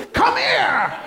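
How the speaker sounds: sudden very high-pitched holler; angry parent's yell. Acted. Parent summoning a child in trouble with police, mock-furious yell.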